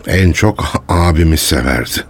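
ordinary talk

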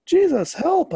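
panicked high, loud scream for help; sinking Peter crying out to Jesus. Acted. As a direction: scream as the water swallows you. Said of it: "I cried".

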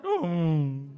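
loud, drawn-out mock dismay; a dog hears the sausages went to the cat; dog's disappointed oh. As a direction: groan it like a let-down dog.

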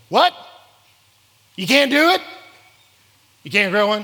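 loud, high mock disbelief; hammed-up shock that he can't do it. A grown-up mocking a child, loud and high.

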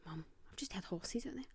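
hushed whisper to mum; retold whispered alarm. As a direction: breathe it out secretly. Telling mum about horses.

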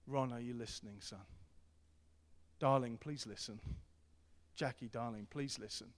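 put-on soft, gentle calling of a child's name; lightly voiced loving father; tender fatherly call. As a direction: call them softly by name.

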